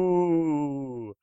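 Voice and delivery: long, high, falling "wooo"; mock ghostly howl; imitated ghost wail. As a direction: moan it like a pretend ghost. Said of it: "pretending to be a ghost ... and going".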